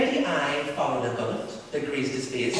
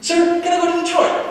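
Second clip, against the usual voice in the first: pleading schoolboy; 12 dB louder than usual. Acted. Boy asking teacher, pleading voice.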